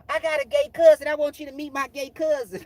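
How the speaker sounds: high, loud matchmaker impression; eager matchmaking friend. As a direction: pipe up as the pushy friend. Acted quote of pushy matchmaking friends.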